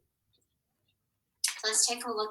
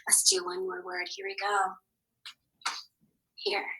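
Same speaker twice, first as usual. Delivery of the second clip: normal talking voice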